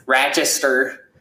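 slow, nasal upstate New York "Rochester"; a local's drawn-out "Rochester"; Rochester accent. Shown as a demonstration. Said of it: "that's my best impression of an accent".

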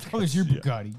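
echoing the boast in a put-on voice; a hammed-up flashy bragger; mock bragging. Friend repeating the influencer's boast, in a put-on mock voice. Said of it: "that's, like, how he talks".

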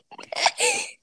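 high giggle. Pretend. High giggle in girl act.

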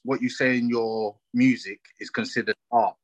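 usual speaking voice

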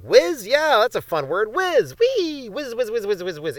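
high-pitched playful glee, easing off at the end; giddy excitement. Acted. Marketers falling for a fun word, giddy and high. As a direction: squeal the word like a new toy.

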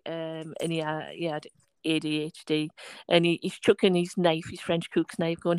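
ordinary talk

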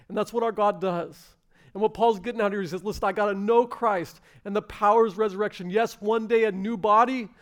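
high, loud pulpit delivery; impassioned preaching. Real, not acted. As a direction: preach it with rising fervor. Preaches at full force.

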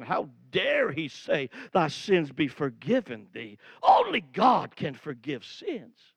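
a hint of offended religious leaders; indignant scribes.

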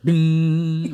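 long ringing "ding" on one high note; imitated church bell. Imitating a church bell striking twelve, drawn-out ding. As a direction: sustain it like a bell toll. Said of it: "when, like, a church, like, hits, like, twelve, it's like".

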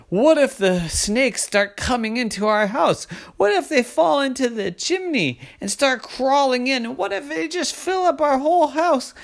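high, rising what-if panic; panicked shouting. Acted. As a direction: spiral upward into alarm. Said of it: "What's all the shouting?".

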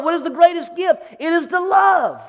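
rising to a full shout; preaching shout. Acted. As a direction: proclaim it with fire. Preacher building to climax, shout.

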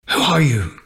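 ordinary delivery

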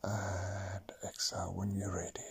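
whispered exhale cue; whispering. Acted. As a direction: breathe it out softly. Breathing cue in meditation.

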